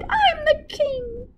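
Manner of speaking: very high, slow wailing tantrum; whiny king. A narrator's reading. Spoilt king whines at the cook, very high and slow. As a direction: wail it like a toddler.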